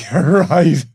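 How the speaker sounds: high, very loud mock cry; hammed-up rapturous shout; ecstatic shouted agreement. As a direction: belt it out as the overcome king. King repeats it, louder.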